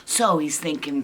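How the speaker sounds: ordinary delivery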